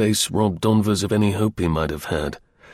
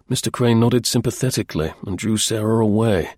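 plain reading voice

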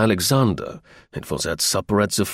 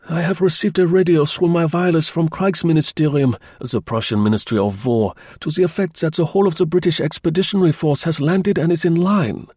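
put-on higher, grave old baron; German baron; 7 semitones higher than usual. Baron warning spy in his shut study, higher grave voice. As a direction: voice the Baron, urgent and secret.

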